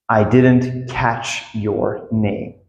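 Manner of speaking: very slow, evenly spaced words; careful, slow teaching demo; slow model. Shown as a demonstration. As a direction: say each word slowly and separately. Teacher models phrase slowly.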